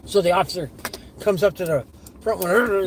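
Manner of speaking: usual speaking voice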